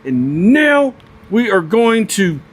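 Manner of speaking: nerding out over the knives, squealing with excitement; real excitement. Real, not acted. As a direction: voice jumps way up with delight. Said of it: "I'm so excited right now".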